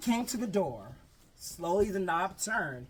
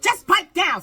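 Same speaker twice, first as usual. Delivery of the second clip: high, loud scolding; hammed-up strict scold; stern hush; 11 semitones higher, 13 dB louder than usual. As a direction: snap at the kids. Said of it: "said Miss Swamp".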